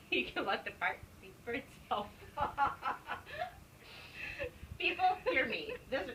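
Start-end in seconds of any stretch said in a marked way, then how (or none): 2.1-3.5 giggle. Real, not acted. Laughing while reading.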